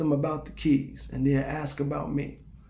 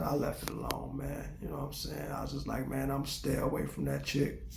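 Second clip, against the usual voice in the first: much softer, half-whispered aside; hushed aside; 14 dB quieter than usual. Real, not acted. Telling viewers he backed off, softly hushed. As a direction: lean in and keep it down.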